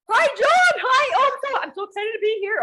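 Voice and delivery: very high, loud, gushing mock squeal; nervous excitement. Caller greeting John on the call, nervously overexcited. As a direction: squeal it like an overexcited fan re-enacting the moment. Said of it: "Oh, I'm really nervous".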